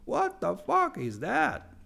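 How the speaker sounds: high, drawn-out baffled cry; mock bewilderment. Beginners look at Cyrillic alphabet, mock-bewildered. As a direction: imitate a shocked beginner, slow and high-pitched.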